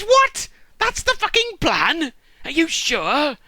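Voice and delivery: very high, loud mock disbelief; hammed-up appalled shriek; incredulous. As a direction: squawk in outrage at a stupid idea. Acted son appalled by the plan.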